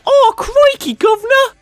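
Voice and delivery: high-pitched, hammed-up mock Cockney accent. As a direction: squeak it out as a cartoon Cockney. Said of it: "the main character's dialogue was".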